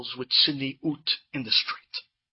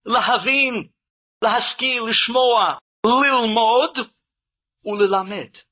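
louder, rising chanted Hebrew plea; fervent prayer; 5 semitones higher, 4 dB louder than usual. Acted. Preacher reciting the daily tefillah, fervent. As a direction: pour the prayer out with growing force.

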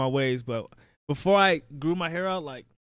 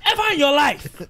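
mock scolding parent; 11 semitones higher than usual. Parent scolding the kid at haircut time, high mock yell. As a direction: bark it like a strict parent.

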